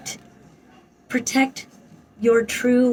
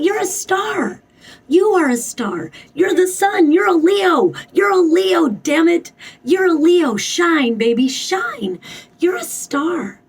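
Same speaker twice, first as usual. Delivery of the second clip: excited hype; 7 semitones higher, 3 dB louder than usual. Real, not acted. Hyping up the listener.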